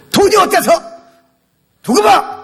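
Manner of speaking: high, loud, punchy comeback; defiant retort. Shown as a demonstration. Preacher modeling a reply to taunts about money, defiant shout. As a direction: shoot back without backing down. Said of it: "딱 얘기해야죠".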